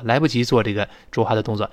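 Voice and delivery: ordinary talk, nothing marked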